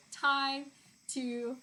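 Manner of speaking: playful, slow proclamation that it's showtime; mock announcement. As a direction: announce it grandly, stretching the words out. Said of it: "I'm like".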